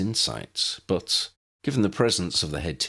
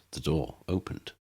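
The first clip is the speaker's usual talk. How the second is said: ordinary delivery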